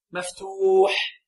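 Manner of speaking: slow, prolonged مفتوح; drawn-out answer. Shown as a demonstration. Teacher completes the rule with the class, drawn-out مفتوح. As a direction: stretch the long vowel of مفتوح very slowly.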